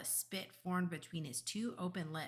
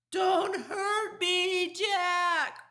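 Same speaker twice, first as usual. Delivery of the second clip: high, slow, loud wail; pleading yell; 13 semitones higher, 11 dB louder, much slower than usual. A narrator's reading. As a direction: beg at the top of your voice. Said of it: "he yelled".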